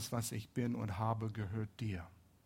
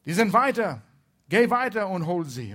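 loud, high shouted command; raised, carrying call across a field; 6 semitones higher, 9 dB louder than usual. Acted. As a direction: shout it to someone far away. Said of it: "rief er zu seinem".

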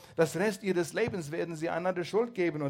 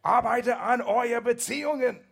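loud and higher, pressing every word home; emphatic appeal; 8 semitones higher, 10 dB louder than usual. Real, not acted. Preacher urging couples to work on their marriages, loud and emphatic. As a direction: drive it home to the congregation. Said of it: "ich betone es hier".

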